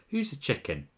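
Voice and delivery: ordinary talk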